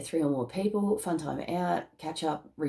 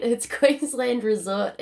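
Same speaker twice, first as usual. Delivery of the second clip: laughing; 6 semitones higher, 5 dB louder, slower than usual. Real, not acted. Joking about the incense brand.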